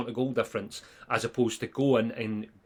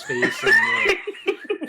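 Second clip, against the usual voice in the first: high laughing voice while mocking; laughing mockery; 6 dB louder, much slower than usual. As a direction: laugh through the mocking of him.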